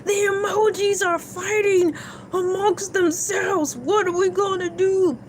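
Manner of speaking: high, squeaky emoji voice, all in a tizzy; little emojis fretting in a high voice; panicked emojis. Acted.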